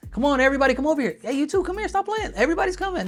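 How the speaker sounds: high mock group call; mock party hype. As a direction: ham up a hype man rounding people up. Imitating King calling everyone.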